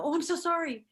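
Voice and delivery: apologizing again to the man, high voiced; flustered apology. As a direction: say sorry in a rush. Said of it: "I go".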